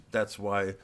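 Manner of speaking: ordinary talk, nothing marked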